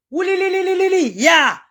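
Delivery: high trilling li li li cry; ululation. Real, not acted. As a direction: ululate like a mourning wail. Ululating in lament over injustice.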